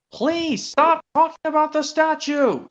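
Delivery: high, slow, cross little engine voice; cross Percy. Retold or imitated. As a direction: scold like an annoyed small engine. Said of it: "said Percy crossly".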